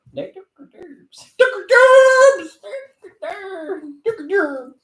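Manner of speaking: high, mock-anguished laughing cry; hammed-up laughing lament; laughing wail. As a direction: wail it like you are fake-sobbing with laughter.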